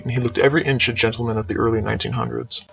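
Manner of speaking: plain reading voice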